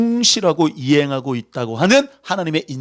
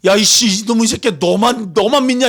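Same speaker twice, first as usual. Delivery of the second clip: higher, louder mock scolding; angry siblings; 8 semitones higher, 4 dB louder than usual. Siblings cursing at Moses in a jealous rage. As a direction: snap at him like jealous siblings.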